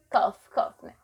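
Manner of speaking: staged coughs; fake coughing. Shown as a demonstration. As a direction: cough on purpose to show the sound. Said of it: "O barulho da tosse".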